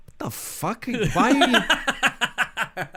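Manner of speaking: high-pitched, drawn-out mock bewilderment; confused dad. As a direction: play a baffled father questioning his son, half laughing. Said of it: "imagine the confused look on his face".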